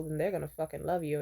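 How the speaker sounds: ordinary delivery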